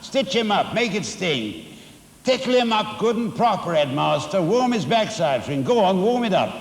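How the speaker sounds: shrieking Missus Pratchett. A narrator's reading. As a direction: egg the headmaster on like a crowd at a match. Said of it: "shrieked Missus Pratchett".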